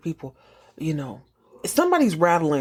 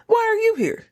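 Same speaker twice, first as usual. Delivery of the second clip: much higher, exasperated question; incredulous squeal. Retold or imitated. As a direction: shoot it up high in disbelief. Shocked question to groundskeeper.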